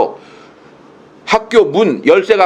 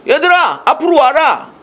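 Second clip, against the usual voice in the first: higher, commanding call; calling students forward; 10 semitones higher than usual. Retold or imitated. A lecturer calling students to the front, raised. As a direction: call the back rows up front. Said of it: "옛날엔 그랬는데".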